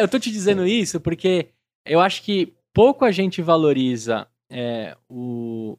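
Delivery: ordinary talk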